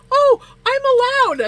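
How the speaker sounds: very high, loud nervous blurt; retold shaky panic. Shaking driver answering the cop about passengers, mock panic. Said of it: "As I'm, like, shaking".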